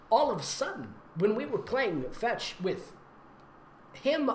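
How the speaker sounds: ordinary delivery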